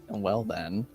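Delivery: usual speaking voice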